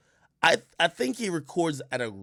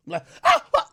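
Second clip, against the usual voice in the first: startled; 13 semitones higher, 7 dB louder, faster than usual. Retold or imitated. Startled waking next to dog.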